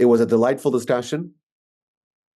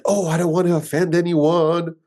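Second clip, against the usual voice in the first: higher, mocking a timid, apologetic person; 6 semitones higher, 4 dB louder than usual. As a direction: parody someone afraid to offend. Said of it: "are fearful of debate".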